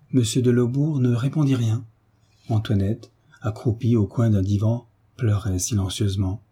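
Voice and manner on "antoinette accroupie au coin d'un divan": ordinary delivery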